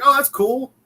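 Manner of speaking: high mock-impressed squeal; impressed friends. Friends reacting to the team name, mock-impressed and high. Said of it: "And they're like".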